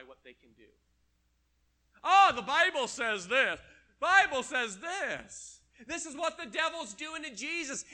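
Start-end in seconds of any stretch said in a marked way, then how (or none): 2.0-3.6 louder mock whine of a scripture-twister; mocking quote. Preacher parodies a scripture-twister, loud mocking voice. 4.0-5.6 drawn out, slow, loud mock repeat. Preacher repeats the excuse slowly, stretched loud mockery. Mocking someone misusing scripture.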